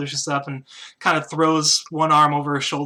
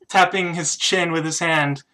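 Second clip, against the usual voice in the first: normal talking voice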